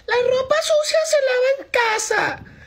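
very high falsetto mock woman; women scolding a man who exposes his partner, mock falsetto; mocking women's chorus. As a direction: squeak it like a preachy lady scolding. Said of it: "van a salir todas las mujeres a decir".

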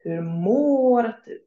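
"Hur mår du?" slow with a long, stressed "mår"; slow, careful phrase. Shown as a demonstration. Teacher modelling the long å in mår, slowly. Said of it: "for example, in mår".

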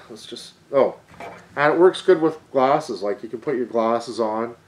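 muffled behind cloth mask; speaking with mouth covered; through a face mask. Real, not acted.

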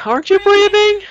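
very high squealed question; squeaky retort. Real, not acted. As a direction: ask it in a squeal. Teasing retort to breather request.

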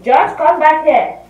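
sharp call across the room. Real, not acted. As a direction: call him back, loud and high. Calling to someone off camera.

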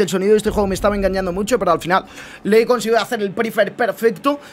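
normal talking voice

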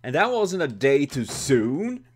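grudging, sarcastic thanks; sarcastic relief. Real, not acted. Player finally gets a weapon, sarcastic loud relief.

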